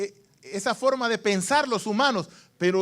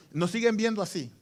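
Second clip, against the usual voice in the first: ordinary talk, nothing marked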